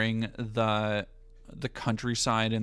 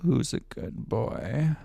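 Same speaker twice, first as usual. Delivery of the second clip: slow, voiced dog praise; slow voiced take; slower than usual. Shown as a demonstration. Second paid take, said slowly in full voice. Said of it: "I can do it again".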